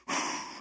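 imitated gust of wind; blown wind sound. Preacher acts out the wind that says nothing, blown whoosh. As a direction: blow out air like passing wind.

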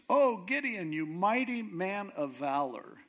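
grand, high-pitched mock hailing; tongue-in-cheek. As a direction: draw it out like teasing praise for a hero. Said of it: "tongue firmly planted in his cheek".

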